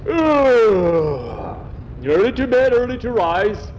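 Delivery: high, loud pompous proverb; gruff farmer. Acted. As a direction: boom the saying like a rough farmer. Farmer groans and declares.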